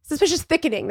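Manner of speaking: high voice with weight on "suspicious"; resident's phrase quoted in a high voice; quoting the resident. Retold or imitated. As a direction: mimic the doctor, leaning on "suspicious". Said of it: "That's what she said".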